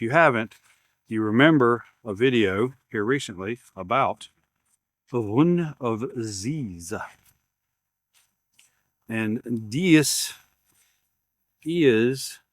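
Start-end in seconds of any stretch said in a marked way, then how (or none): 5.1-12.4 a slow, badly faked German accent; hammed-up German accent, drawn out; fake German accent. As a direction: try a bad German accent and let it fail. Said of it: "my fake German accent don't work very well".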